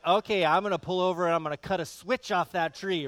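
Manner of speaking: loud, high scolding dad; hammed-up angry father warning; stern father threat. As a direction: threaten like an old-school dad. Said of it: "my dad would be like".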